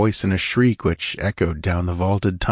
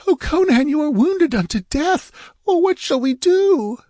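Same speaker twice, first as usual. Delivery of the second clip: sobbing hysterically. A narrator's reading. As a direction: cry out in frantic grief. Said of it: "sobbing hysterically".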